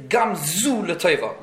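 "Zu" drawn out slowly and leaned on; slow the phrase down and hold "Zu"; stress on ZU. Shown as a demonstration. Teacher explaining another way of going through a tzara, stress on "Zu". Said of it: "the emphasis on the word Zu".

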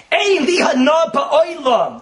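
slow, loud, high proclamation; proclaimed loud. Acted. Preacher declaring God's joy, slow and loud. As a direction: call it out slowly with joy.

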